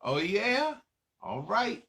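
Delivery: ordinary talk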